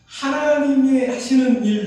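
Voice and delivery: ordinary talk, nothing marked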